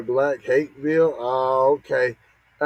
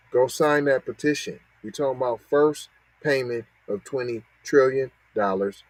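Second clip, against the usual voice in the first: usual speaking voice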